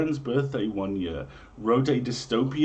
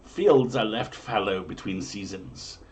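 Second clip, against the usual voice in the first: grand, raised olde-English storyteller read; dramatic prophecy reading; 4 semitones higher than usual. Acted. Host reading medieval omens to listeners, grand storyteller voice. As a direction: proclaim each omen like a town crier.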